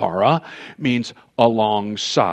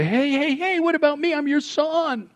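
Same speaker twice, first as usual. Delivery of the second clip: high, panicked cry for help; pleading up high, hey hey hey; desperate plea; 14 semitones higher than usual. Retold or imitated. Son begging his judge father before the gavel falls, high and panicked.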